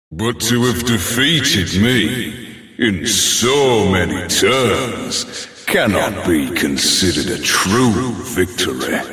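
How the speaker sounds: normal talking voice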